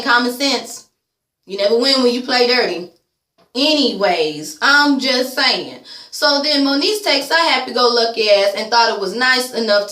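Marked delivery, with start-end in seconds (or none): 4.6-5.8 slow, stretched, leaning on I'm; slow drawn-out catchphrase. Real, not acted. Vlogger signing off a rant with a slow, pointed drawl. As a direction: drag it out and lean on "I'm".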